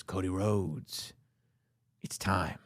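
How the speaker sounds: slow, hushed, ominous promo; menacing Rock voice. Retold or imitated. Retelling The Rock's promo to a friend, slow ominous voice. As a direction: hiss the threat slowly. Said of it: "and he goes".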